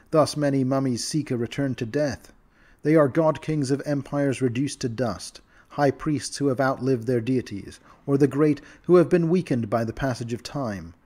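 plain reading voice

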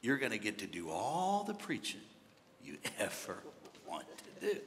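low, soft amused murmur; laughing tone. Retold or imitated. The Lord teasing a griping young preacher, quietly laughing tone. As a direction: draw it out quietly, chuckling inside. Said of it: "kind of in a laughing tone".

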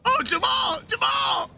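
very high, loud holler; shouted name. Pretend. Hollering at Jamal talking in his sleep, high shout. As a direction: yell the name out at full volume.

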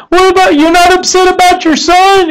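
two mothers baffled by a calm third mom; moms ask incredulous. Retold or imitated.

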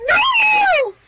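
very high yelled no; anguished shout. Real, not acted. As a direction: scream it in despair. Reacts to lag, shouts.

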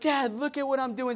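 put-on a kid begging dad to watch; excited child. Kids jumping on dad, imitated excited child. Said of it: "And they're like".

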